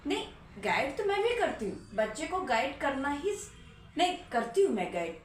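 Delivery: usual speaking voice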